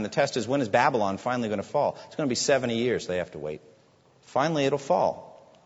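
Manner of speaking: usual speaking voice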